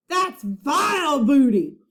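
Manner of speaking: slow, loud pirate growl; disgusted pirate roar. A narrator's reading. Captain Bones rejecting the book as vile booty, slow loud pirate growl. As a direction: spit the words out.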